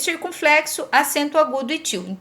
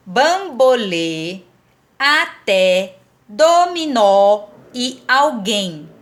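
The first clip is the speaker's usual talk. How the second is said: slow "bambolê, até, dominó e alguém", each last syllable leaned on; teacher spacing out "bambolê, até, dominó e alguém" to show final stresses; 3 semitones lower, much slower than usual. Shown as a demonstration. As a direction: dictate "bambolê, até, dominó e alguém" slowly, stretching the endings. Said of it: "a última sílaba é a tônica".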